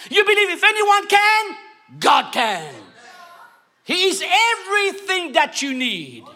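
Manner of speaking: call-and-response sermon, pushing hard; preaching at full force. Acted. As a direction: preach it out to the whole church.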